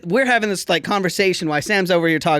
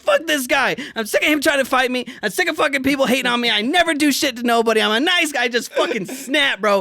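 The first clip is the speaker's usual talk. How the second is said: higher, worked-up rant; exasperated inner rant; 8 semitones higher than usual. Retold or imitated. Retelling his breaking point to the friend: high, heated rant. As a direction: boil over in your head. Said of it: "I was like".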